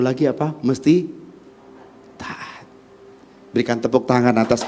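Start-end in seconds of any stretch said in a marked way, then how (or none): 2.2-2.7 hushed emphasis on obedience; near-silent whisper. Real, not acted. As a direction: breathe the word softly. Whispered key word.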